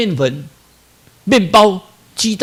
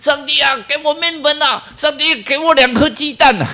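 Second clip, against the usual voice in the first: put-on child's loud plea, pitched higher; loud child prayer; 7 semitones higher, 3 dB louder than usual. Baker imitates child praying aloud, loud high plea. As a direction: call out to God like a needy child. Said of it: "讲得好大声".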